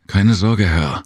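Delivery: eager, meek reassurance; submissive. Acted. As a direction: placate the master quickly. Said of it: "sagte Jem unterwürfig".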